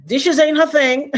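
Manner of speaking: high, loud teasing through a laugh; laughing tease. Real, not acted. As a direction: laugh as you rib her. Teasing her daughter.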